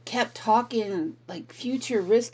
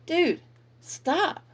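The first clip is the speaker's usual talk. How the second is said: high, sharp exasperated dude stop; 10 semitones higher than usual. Real, not acted. Telling her ex to finally stop, exasperated high plea. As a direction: cut him off, fed up and pleading.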